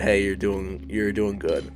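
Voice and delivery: ordinary talk, nothing marked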